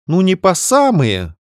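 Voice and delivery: high, slow hesitant sister; falter, caught out. Acted. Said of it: "замялась сестра". (замявшись)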